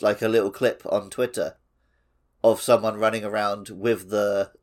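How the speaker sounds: normal talking voice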